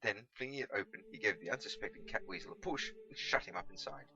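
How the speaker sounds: plain reading voice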